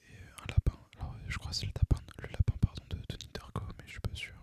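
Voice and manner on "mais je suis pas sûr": ordinary talk, nothing marked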